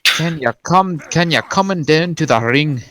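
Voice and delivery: put-on announcer calling in a Scottish accent. Commentator calling the fighter to the ring in a Scottish accent. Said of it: "read everything in a Scottish accent".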